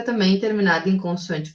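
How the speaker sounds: usual speaking voice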